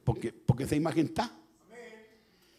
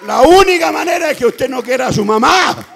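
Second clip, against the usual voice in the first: very loud, raised-pitch shouted preaching; impassioned preaching; 7 semitones higher, 15 dB louder than usual. Real, not acted. Preacher urging the congregation about a mother's coming, shouting. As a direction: drive the point home at full voice.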